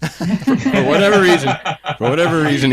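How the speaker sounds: talking through a laugh; high, laughing delivery. Real, not acted. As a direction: crack up while trying to explain. Cracks up after his imitation.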